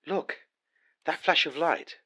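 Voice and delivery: high loud eager look; excited pointing. Acted. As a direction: urge them to see it. Pointing out a distant light.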